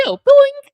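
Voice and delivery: high, loud boing sound; spring it up like a cartoon. Playful sound effect.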